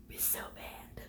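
hushed whisper. Real, not acted. Viewers whispering during a tense scene. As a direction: whisper so the scene stays audible.

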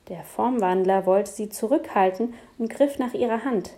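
ordinary delivery, nothing marked

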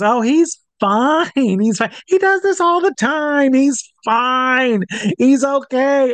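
very high, mock-breezy wife voice; hammed-up high-pitched dismissal; imitating laughing wife. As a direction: squeal it, brushing off the worry. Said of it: "and she's laughing".

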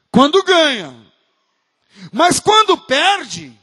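a pastor building to a loud rebuke; fervent preaching. Acted. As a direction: drive it home from the pulpit. Preacher building to full force.

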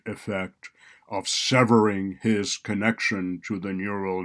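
loud, solemn judge reading a verdict; stern judge. A narrator's reading. Judge pronounces verdict loudly.

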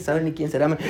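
muttered under the breath with a laugh; quietly puzzled aside to himself; inner thought. Retold or imitated. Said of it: "Y en mi mente".

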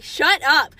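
a loud snap of shut up; telling her to shut up, loudly; imagined shout. Pretend. To Leah at the restaurant, a loud pretend shout. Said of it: "wanted to look at her and be like".

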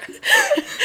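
high burst of laughter. Real, not acted. Laughing at joke.

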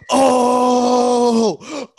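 high, loud hooting of mock amazement; mock awe. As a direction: play the impressed buddy, hamming it up. Friend reacts to the rap.

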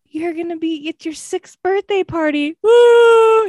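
hammed-up sob rising to a loud wail; fake crying. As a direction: overdo the teary mom bit. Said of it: "I pretend to cry".

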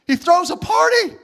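shouted high proclamation; preacher shouting the good news; emphatic shout. Real, not acted. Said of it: "preaching at full force".

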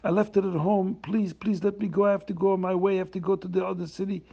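pleading poor man. Acted.

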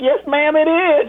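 answered through laughter; laughing reply. Retold or imitated. Answering the caller while breaking up laughing. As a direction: laugh while saying yes ma'am. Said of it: "I was like".

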